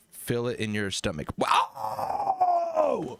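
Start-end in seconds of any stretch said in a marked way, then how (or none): 1.4-3.2 long, high, loud "wow" howl; mock belted wail. Mocking the belting lesson, high wailed shout. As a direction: belt it out as a joke.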